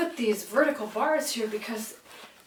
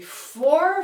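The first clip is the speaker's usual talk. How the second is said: four punched high and loud; accent the fourth beat; stress on four; 7 semitones higher, 9 dB louder than usual. Shown as a demonstration. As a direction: hit "four" hard and high. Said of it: "So each of these fourth beats".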